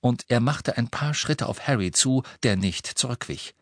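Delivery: ordinary delivery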